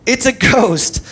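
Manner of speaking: high, drawn-out cry of fear; loud, slow retold fright; mock terrified cry. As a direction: cry out as frightened disciples, slightly hammed-up. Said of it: "they were terrified and said".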